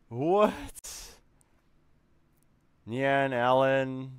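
ordinary talk

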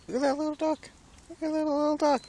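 high, sweet pet talk. Real, not acted. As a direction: coax the little birds with a sing-song voice. Cooing at a little duck.

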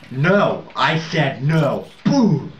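loud, high shouts of protest, then the blast; rising shouts ending in a big boom; real yelling. Real, not acted. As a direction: yell it at the screen. Yelling at game events.

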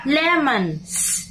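"Lemons" with a clear final s sound; plural model. Shown as a demonstration. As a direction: stress the plural ending on "Lemons". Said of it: "Và hình thức số nhiều".

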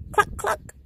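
high, breathy hen cluck. Acted.

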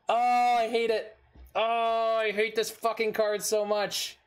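high, heated griping at the card; exasperated rant. Real, not acted. Player griping to viewers about the hated card, high and heated.

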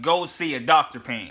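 ordinary talk, nothing marked

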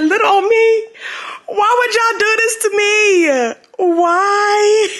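high, whiny plea breaking into laughter; playful high-pitched lament; mock-wounded wail. Real, not acted. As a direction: wail it like you've been betrayed, half laughing. Wailing over the article about her.

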